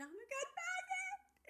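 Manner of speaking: high, slow, tearful wail of a name; teary mock wail. Retelling crying in the car park, a high slow tearful wail. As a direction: whimper it like you're about to cry.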